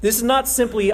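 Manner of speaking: ordinary talk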